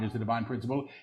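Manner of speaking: quick, soft undertone; hushed preview. Real, not acted. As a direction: say it softly and rapidly before proclaiming it. Quick soft take before repeating aloud.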